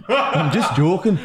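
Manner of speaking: co-host imitating a British accent; light mock Scottish or Geordie accent; imitated British accent. Co-host echoes host while guessing the accent, mock British accent. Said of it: "That's Scottish".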